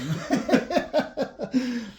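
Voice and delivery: high laugh, falling away; real laugh. Real, not acted. Player after teasing Scott's army, laughing. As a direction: laugh high at your own jibe.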